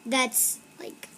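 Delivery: soft breathy ASMR whisper. Acted. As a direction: whisper close to the mic. ASMR whispering over slime.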